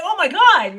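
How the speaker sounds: high, loud exclamation; retold amazement. Retelling shock at a weird coincidence, loud and amazed.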